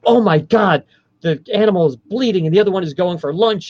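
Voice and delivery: overhype it like an overwrought narrator, pitched high; hype energy. Acted. Interviewee mimicking what a documentary narrator would never say, with hype energy. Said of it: "there's not hype energy".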